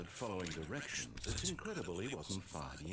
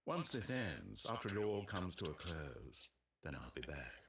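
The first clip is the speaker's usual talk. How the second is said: vast deep cosmic voice; 4 semitones lower, 16 dB louder than usual. Acted. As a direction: speak like a distant god. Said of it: "He's talking like God".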